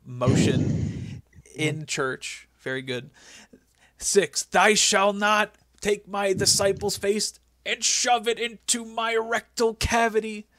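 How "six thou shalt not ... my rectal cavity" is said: slow, booming mock-biblical decree; mock commandments. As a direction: hand down the law slowly. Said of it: "he goes".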